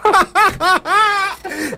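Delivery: high nervous giggle breaking out; nervous laughter. Real, not acted. Caller on a prank call laughs at his own nerves. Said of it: "ahora me río por los nervios que tengo".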